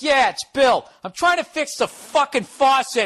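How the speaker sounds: loud, high exasperated yell; retold screaming. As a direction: hit the ground running, yelling down the phone. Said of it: "screaming at him".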